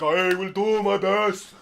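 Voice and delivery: loud, high, slow giant voice; earnest giant. Acted. Giant promising the pixie to be careful, loud and slow. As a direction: promise solemnly like a clumsy giant. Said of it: "He's like".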